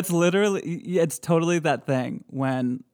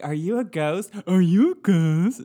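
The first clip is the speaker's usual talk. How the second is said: eager question, louder on repeat; imitating ghost hunter; 4 semitones higher, 5 dB louder than usual. Imitating a ghost hunter asking the ghost. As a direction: ask it like a nervous ghost hunter. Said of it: "someone like".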